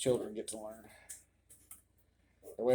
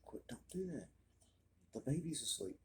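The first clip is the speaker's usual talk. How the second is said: whispering over a crib; 3 semitones higher, 8 dB quieter than usual. Real, not acted. Hushing kids, baby asleep.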